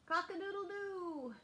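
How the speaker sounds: high crowing cock-a-doodle-doo; rooster crow. Pretend. Rooster crowing on chicken page.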